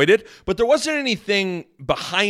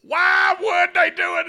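outraged Nebraska fan; 10 semitones higher, slower than usual. Retold or imitated. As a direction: whine like an indignant local fan. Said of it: "It's like".

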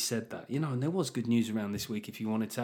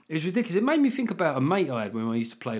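usual speaking voice

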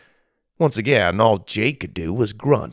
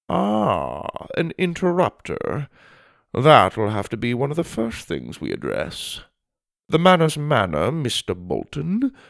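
lofty, higher-pitched formal lecture; pompous headmaster; 5 semitones higher than usual. Acted. Headmaster lectures an interrupting new pupil, pompous and disapproving. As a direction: savor each grand word of the mission.

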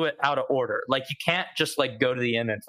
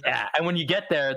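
ordinary delivery

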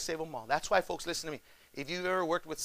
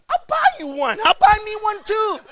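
very high and louder, laughing as he offers; eager, laughing offer; 11 dB louder than usual. Retold or imitated. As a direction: gleefully offer to buy one each. Pastor offering to buy deodorant.

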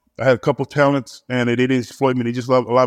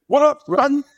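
put-on much higher, cocky New York accent; 14 semitones higher, slower than usual. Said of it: "I'm on New York accent".

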